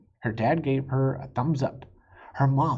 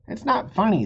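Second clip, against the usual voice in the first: protesting; 9 semitones higher, 5 dB louder than usual. A narrator's reading. Suzanne protests.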